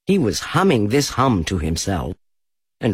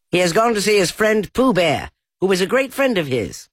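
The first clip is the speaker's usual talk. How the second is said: rabbit voice; 11 semitones higher, 3 dB louder than usual. A narrator's reading. Rabbit voiced, still disguised.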